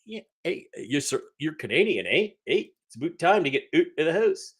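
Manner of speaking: mock Toronto Canadian with swooping pitch; hammed-up Canadian accent. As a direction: imitate an exaggerated Canadian, leaning on "eh". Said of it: "People really talk like that".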